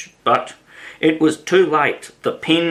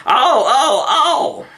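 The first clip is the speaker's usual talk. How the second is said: much higher, louder whistle-like shrieks; screaming cries; 12 semitones higher, 4 dB louder, slower than usual. A narrator's reading. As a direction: shriek in mock pain. Queen screaming about her finger.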